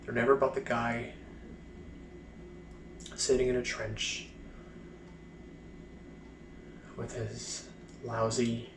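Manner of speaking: halting, bitter recollection, long silences between phrases; mostly subdued, dragging each painful fragment out; letting out repressed emotion. Acted. Blinded soldier recalling the trench, letting out repressed bitterness haltingly. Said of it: "letting out some, some negative emotion".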